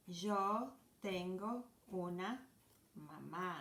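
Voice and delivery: slow, careful demo of Yo tengo una mamá; slow model sentence. Shown as a demonstration. Teacher showing kids how to say mom, slow and clear. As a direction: spell it out slowly for learners. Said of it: "you say".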